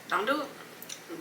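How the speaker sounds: slow, soft "Don't do it."; hushed, drawn-out "Don't do it."; soft warning. Real, not acted.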